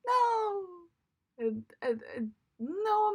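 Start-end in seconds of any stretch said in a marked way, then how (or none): 0.1-0.7 mock Simba scream. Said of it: "and then Simba".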